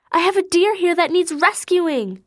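put-on high, fluttery, fussing old lady; a flustered lady clucking over the poor animal; fussy lady caller. A narrator's reading. Caller to Keisha about the deer, high fussy lady voice.

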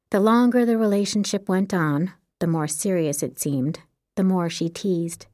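ordinary delivery

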